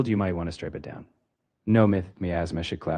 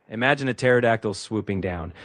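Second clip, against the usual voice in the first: ordinary talk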